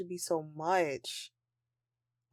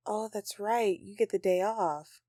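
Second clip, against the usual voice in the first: usual speaking voice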